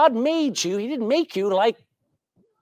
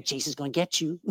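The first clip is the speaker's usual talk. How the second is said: softer, lower, spooky voice from the dark; eerie parrot voice; 5 semitones lower, 8 dB quieter, faster than usual. Acted. A parrot in the dark spooks the burglar, lower and hushed. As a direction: hush it like an unseen warning.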